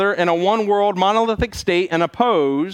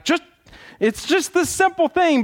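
exasperated; 10 semitones higher than usual. Retold or imitated. Frustrated worker voiced.

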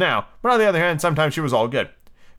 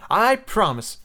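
high, earnest pledge; loyal; 8 semitones higher, much slower than usual. A narrator's reading. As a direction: pledge loyally to your captain, pitched up. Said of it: "said John loyally".

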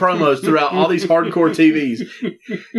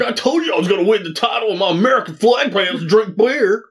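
mock wrestler boast, pitched up; Sandman; 6 semitones higher than usual. Podcaster mimicking a wrestler over there smoking, mocking impression. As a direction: send up a cigarette-smoking wrestler's promo. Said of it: "Sandman's over there smoking a cigarette like".

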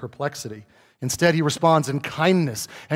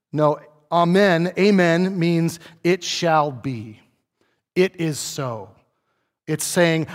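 normal talking voice

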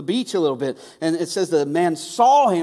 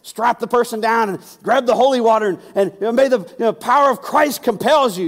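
mock exorcist; 4 semitones higher, 6 dB louder than usual. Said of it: "one of those horror movies".

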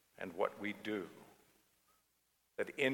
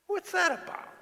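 startled puzzlement; 4 dB louder than usual. Retold or imitated. Thinking as a stranger honks.

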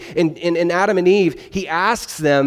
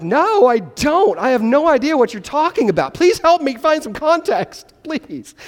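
mock pleading; 9 semitones higher than usual. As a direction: beg them for context, half-joking. Pleading confused reply.

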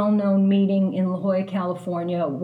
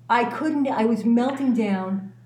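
ordinary talk, nothing marked

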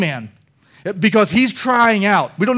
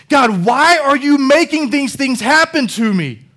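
higher, impassioned demand of God; confronting God; 6 semitones higher, 4 dB louder than usual. Retold or imitated. Man getting in the face of God, confrontational. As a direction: get in God's face with a raised, pleading challenge. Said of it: "getting in the face of God".

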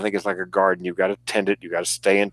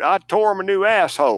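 higher, a light Southern lady's voice; Southern woman; 7 semitones higher than usual. Retold or imitated. Retelling the senator's boast, light Southern lady voice. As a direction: imitate a genteel Southern woman relishing it. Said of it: "a Southern woman to, at heart".

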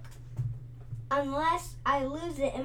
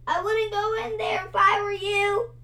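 higher, louder, quick warning cry; frightened cry; 9 semitones higher, 6 dB louder, faster than usual. A narrator's reading. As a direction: warn them fast, scared. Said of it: "he cried".

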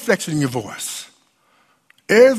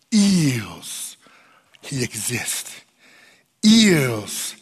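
hushed preaching; 5 semitones lower than usual. Acted. Preacher drops to intimate reflection.

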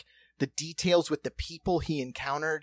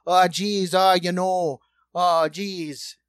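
louder hammed-up Fargo accent; 3 semitones higher, 5 dB louder, slower than usual. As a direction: lay on a mock Fargo accent. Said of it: "that Fargo accent's legit".

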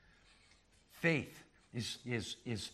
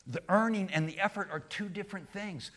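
normal talking voice

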